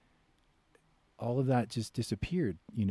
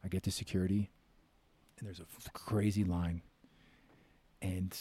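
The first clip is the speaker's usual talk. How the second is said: ordinary delivery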